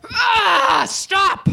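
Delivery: high, far-off shouted rant; Alex Jones yelling. Pretend. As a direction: scream it like a conspiracy ranter. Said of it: "imagine him showing up in your abortion clinic like".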